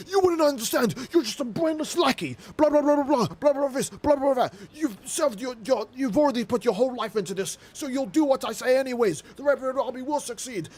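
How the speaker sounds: high, nasal-free squeaky mock of a petty boss; mocking Red voice. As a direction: play the pompous little commander mocking his lackey.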